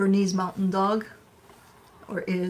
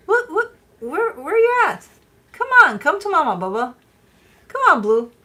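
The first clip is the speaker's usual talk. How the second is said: much higher, louder mock-worried calling; 12 semitones higher, 8 dB louder than usual. Owner keeps calling the dog as if he's lost, high loud pleading calls. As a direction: shout high for a dog you can't find. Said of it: "Call for his name as if he's not around".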